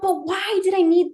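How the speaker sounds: high, quick, hushed bafflement; a confused little voice asking herself why; puzzled self-questioning. Retold or imitated. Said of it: "and you're like".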